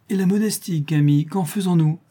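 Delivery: high and bright, teasing through a laugh; laughing exclamation. A narrator's reading. Claire teasing Camille over dinner, high laughing exclamation. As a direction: exclaim it, amused at her friend. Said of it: "s'écria Claire en riant".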